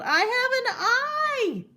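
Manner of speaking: yelling with a laugh, eager to answer; excited yell. Acted. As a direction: blurt it out in proud glee. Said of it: "and Morris yelled".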